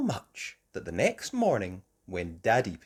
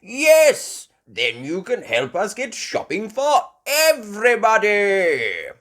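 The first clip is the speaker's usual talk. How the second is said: higher, loud cheery grandpa; Grandpa Pig voice; 9 semitones higher, 12 dB louder than usual. A narrator's reading. Grandpa Pig answering Peppa, loud cheery voice. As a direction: agree heartily like a jolly old man. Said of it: "Grandpa Pig said".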